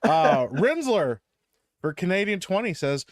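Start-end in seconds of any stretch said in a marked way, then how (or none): none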